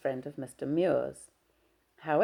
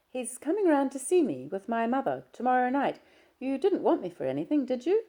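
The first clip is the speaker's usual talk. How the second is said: put-on higher, careful young daughter; young woman's voice; 10 semitones higher, 3 dB louder than usual. Daughter questions her father on the phone, higher and careful. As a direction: ask politely, a little wary, pitched up.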